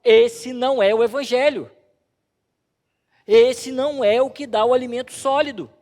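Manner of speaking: loud, emphatic proclamation for the congregation; forceful declaration. Shown as a demonstration. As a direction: say it like Paul, full voice. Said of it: "Vocês têm que dizer como o apóstolo Paulo". (declaração enfática e alta)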